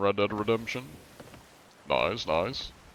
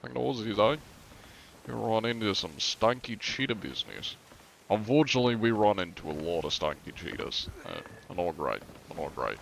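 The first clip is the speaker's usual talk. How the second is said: put-on Australian accent, tailing off softer; 5 dB quieter than usual. Streamer showing chat the Aussies, mock Australian accent. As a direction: play a joking Aussie mate. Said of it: "Or Australian, you know".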